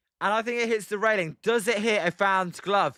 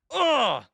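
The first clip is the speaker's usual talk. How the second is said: frustrated groan; 6 semitones higher than usual. Real, not acted. Fed-up fan groaning about England losing, exasperated ugh. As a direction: groan it out like you can't take any more.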